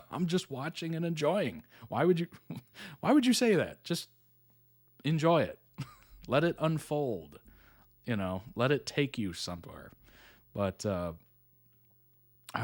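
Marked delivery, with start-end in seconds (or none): 0.1-7.4 why would anyone do that; exasperated. Retold or imitated. Exasperated at a spoiler.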